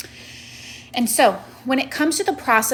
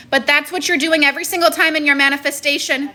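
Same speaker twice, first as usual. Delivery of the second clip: yelling; 5 semitones higher than usual. Real, not acted. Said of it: "Again, you're yelling?".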